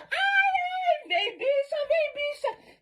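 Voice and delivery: very high, panicky cry of alarm; panicked squeal. Retold or imitated. Fleeing the shouting man, calling her friend in a panicked squeal. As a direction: squeal in fright while running off.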